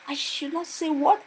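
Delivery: high, loud mocking echo; defiant retort. Lecturer mocking the student's warning, high defiant retort. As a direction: dare her to repeat it.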